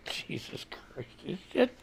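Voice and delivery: slow whispered Jesus Christ under the breath; whispered disbelief. Real, not acted. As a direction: breathe it out slowly, done with it. Fed up with Twitter pictures.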